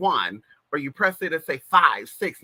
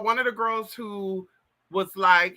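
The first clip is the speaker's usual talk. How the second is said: ordinary talk, nothing marked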